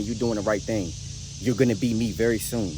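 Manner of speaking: ordinary talk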